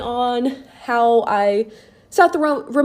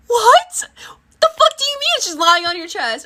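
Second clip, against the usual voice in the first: high, louder freaked-out cry; panicked disbelief; 10 semitones higher, 4 dB louder than usual. Retold or imitated. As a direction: shout it in frightened disbelief.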